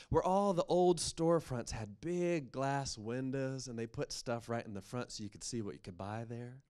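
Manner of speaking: ordinary delivery, nothing marked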